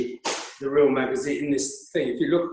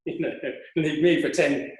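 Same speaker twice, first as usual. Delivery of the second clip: ordinary delivery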